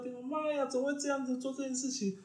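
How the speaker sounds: high squealing oh-my-god, hammed up; mock panic. Retelling her panic over a client's call, high mock squeal. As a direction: shriek it like sudden alarm, played for the story.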